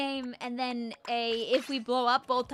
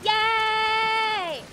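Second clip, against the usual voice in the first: excited cheer; 8 semitones higher, 9 dB louder than usual. Real, not acted. Cheering in game.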